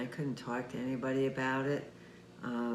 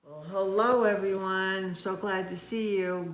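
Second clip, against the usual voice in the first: excited greeting; 5 semitones higher, 6 dB louder than usual. Real, not acted. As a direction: greet the whole room with a big lift. Host bursts in greeting kids.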